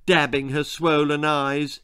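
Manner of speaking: ordinary delivery, nothing marked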